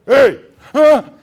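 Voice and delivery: loud, high startling hey; sudden shout. Retold or imitated. Stranger calling out to the boy in the rail yard, loud shout. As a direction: call out sharply in the dark. Stranger surprises him at night.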